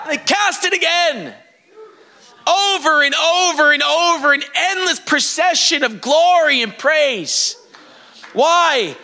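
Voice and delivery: normal talking voice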